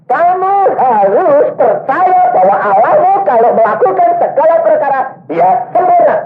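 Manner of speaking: fiery exhortation. Retold or imitated. Preacher urging the young man to believe, in a high ringing voice. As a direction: preach it at him like a command.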